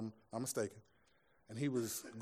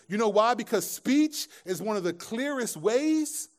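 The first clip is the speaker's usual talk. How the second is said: preaching; 6 semitones higher, 7 dB louder than usual. Acted. Preaching at full force.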